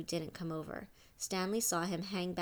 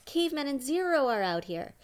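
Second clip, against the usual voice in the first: higher, loud call across a room; shout; 8 semitones higher, 8 dB louder than usual. A narrator's reading. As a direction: call out to the boys inside. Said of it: "Squid shouted back into the rec room".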